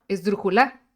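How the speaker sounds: "esdrújula" misstressed as es-dru-ju-LA; stress on last syllable. Shown as a demonstration. Teacher showing a wrong accent, "esdrújula" stressed on LA. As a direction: punch the final LA of "esdrújula", loudly. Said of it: "si estuviera en la última sílaba".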